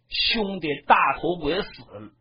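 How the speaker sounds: ordinary delivery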